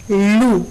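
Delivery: "lu" held on its own; stressed syllable alone. Shown as a demonstration. Teacher naming the tonic syllable of luna, "lu" isolated and stressed. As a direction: give "lu" a separate, weighted beat. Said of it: "la sílaba tónica es".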